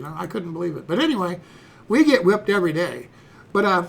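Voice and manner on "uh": usual speaking voice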